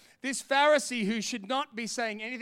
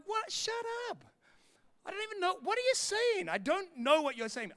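very high, softer incredulous squeal; mock exasperated retort; 9 semitones higher, 4 dB quieter than usual. Preacher replaying his reaction to mum's prediction, incredulous. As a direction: protest high in pitch, coming down at the end. Acted retort to mum, escalating.

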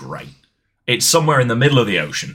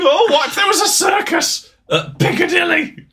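very high, hammed-up excitement; mock wonder. Mocking a writer's literal place-name ideas, high mock excitement. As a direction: squeal it like a dazzling brainwave.

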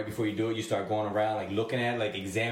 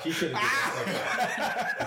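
laughing; 10 semitones higher, much slower than usual. Real, not acted. Laughing tease.

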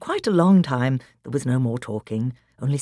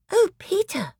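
put-on high-pitched child's gasp of wonder; amazed exclamation. Lucy to Peter in the woody place, amazed exclamation. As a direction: exclaim in awe. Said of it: "exclaimed Lucy".

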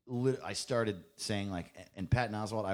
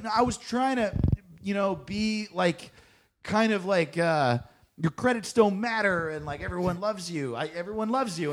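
defensive gushing; 9 semitones higher, 5 dB louder than usual. Retold or imitated. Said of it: "I was like".

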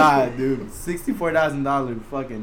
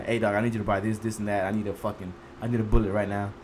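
low, hushed phone voice; soft, low, under-the-table tone; confidential request; 8 semitones lower, 8 dB quieter, faster than usual. Retold or imitated. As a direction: ask a buddy quietly for a secret favor. Retells discreet phone call for bullet.

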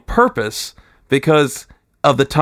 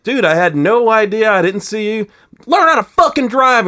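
retold angry driver; 7 semitones higher than usual. Other driver yelling back.

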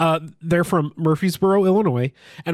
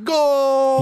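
mock goal shout; 10 semitones higher than usual. Fan imitating a soccer goal call. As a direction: yell it like a football announcer. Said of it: "I'm always like".

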